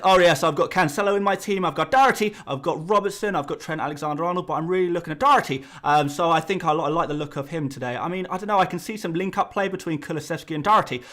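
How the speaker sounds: hammed-up Irish accent, chatty and running on. YouTuber imagining himself suddenly turning Irish mid-video, put-on Irish accent. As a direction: rattle off a team list as a mock Irishman. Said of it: "out into an Irish accent".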